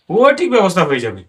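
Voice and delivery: ordinary talk, nothing marked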